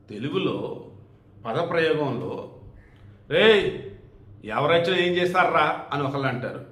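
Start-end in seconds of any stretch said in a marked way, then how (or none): 3.3-5.8 mock challenge. Acting out a rough street challenge.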